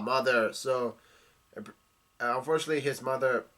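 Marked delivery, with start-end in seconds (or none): none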